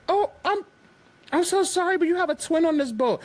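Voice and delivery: high-pitched, overly sweet apology; mock apologetic woman. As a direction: squeak it out like a flustered tourist lady. Woman apologizes, mistaking twin.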